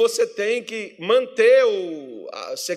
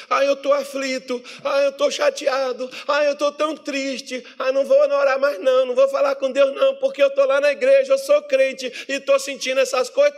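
mock whining believer; 4 semitones higher than usual. Believer making excuses not to pray, mock whining. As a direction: whine out a moper's excuses.

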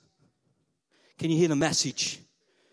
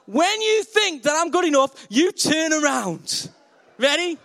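calling out; 7 semitones higher, 3 dB louder than usual. Real, not acted. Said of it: "asks church to play judges".

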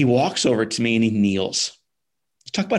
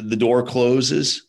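ordinary talk

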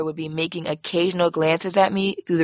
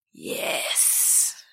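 low hissed whisper; 7 semitones lower, 7 dB quieter than usual. Retold or imitated. The guy on FaceTime hissing yes, low and whispered. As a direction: hiss it out, lower and much softer. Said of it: "he hissed on FaceTime".

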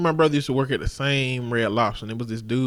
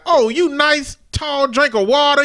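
mock flirt; 12 semitones higher, 5 dB louder, slower than usual.

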